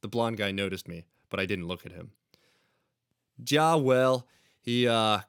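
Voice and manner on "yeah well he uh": put-on Aussie accent. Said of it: "in an Aussie accent".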